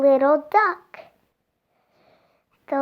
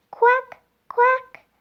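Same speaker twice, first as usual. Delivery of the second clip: high, loud little duck quack; 10 semitones higher, 5 dB louder than usual. Acted. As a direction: quack like a tiny duckling. Ducks quack in story.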